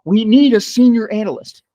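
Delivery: high, loud mock panic cry; hammed-up government shout; mock scream. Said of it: "They scream out".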